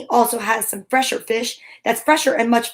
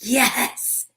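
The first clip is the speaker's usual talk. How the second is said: usual speaking voice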